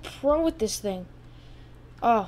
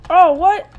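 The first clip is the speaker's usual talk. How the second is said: higher, louder shocked outcry; can't believe what just happened; loud disbelief; 6 semitones higher, 10 dB louder than usual. Real, not acted. Gamer sees something unexpected, loud shocked outcry. Sudden twist in the game.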